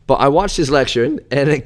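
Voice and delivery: normal talking voice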